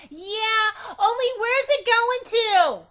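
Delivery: very high, loud, near tears; crying out the question; scared little girl. A narrator's reading. Frightened girl asking again about the bus, tearful and high. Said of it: "I asked again".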